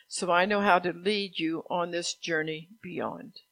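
firm, projected proclamation; prophetic proclamation. Acted. Preacher delivers God's word on the journey, proclaiming. Reads out God's words to congregation.

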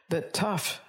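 normal talking voice

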